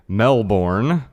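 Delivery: Melbourne as mel-BORN, full second syllable; spelling pronunciation. Shown as a demonstration. Host shows how some people might say it, spelling pronunciation. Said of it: "Some people might say".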